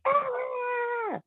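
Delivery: very high, short squeal of frustration; frustrated scream. Retold or imitated. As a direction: squeal out all the stress at once. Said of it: "I was like".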